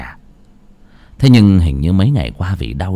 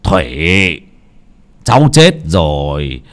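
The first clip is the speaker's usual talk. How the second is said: slow, deep solemn address; low, measured grandfatherly solemnity; lowered, grave voice; 6 semitones lower, much slower than usual. Acted. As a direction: lower the voice and speak gravely to the dead. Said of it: "mà trầm giọng".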